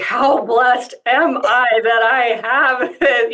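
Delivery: high, slow, laughing through the words; a rueful laugh on a hard day, said slowly. Real, not acted. Woman on a tearful, angry day, laughing through it.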